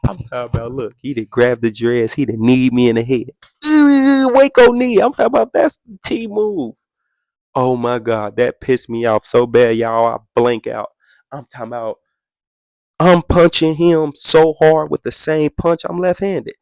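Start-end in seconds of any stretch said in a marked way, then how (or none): none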